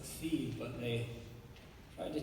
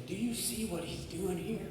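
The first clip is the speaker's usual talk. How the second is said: quoting the teacher; 6 semitones higher, 3 dB quieter than usual. Retold or imitated. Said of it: "she would say".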